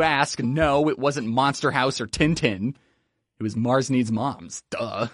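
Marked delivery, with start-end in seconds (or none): none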